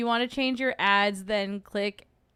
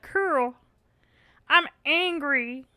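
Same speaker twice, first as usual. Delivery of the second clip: higher, put-on eager voice; acted angry zombie recruit; 6 semitones higher, 4 dB louder, much slower than usual. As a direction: play at being angry, stretching the words. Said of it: "And then he's like".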